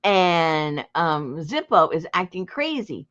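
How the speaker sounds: ordinary delivery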